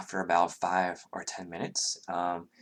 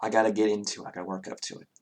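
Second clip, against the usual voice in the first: ordinary delivery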